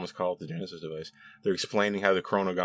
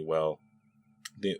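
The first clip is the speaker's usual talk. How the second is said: ordinary talk, nothing marked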